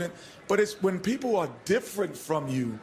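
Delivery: usual speaking voice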